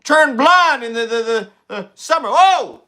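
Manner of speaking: put-on high, slow breathy old man; Joe Biden impression. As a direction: do a drowsy elderly Biden. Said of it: "Do the Sleepy Joe impression".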